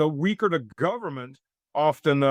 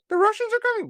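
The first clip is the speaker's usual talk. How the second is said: much higher, put-on voice, falling, stating it; mock alarm; faster than usual. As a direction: announce the doom in a silly high voice. Mocking the Russian Scare.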